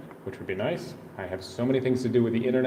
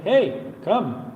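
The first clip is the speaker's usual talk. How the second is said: friendly local welcome; 9 semitones higher than usual. Retold or imitated. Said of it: "everyone's like".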